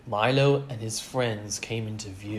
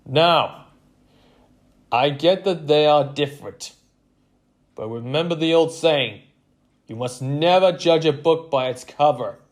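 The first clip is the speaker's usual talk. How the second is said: loud, raised coach's voice; stern pep talk; 5 semitones higher, 9 dB louder than usual. A narrator's reading. Coach defending the newcomers to players, loud and firm. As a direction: address the team firmly and loudly.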